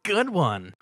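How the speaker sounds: high amused pony; Pinkie Pie voice. A narrator's reading. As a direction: laugh it off brightly.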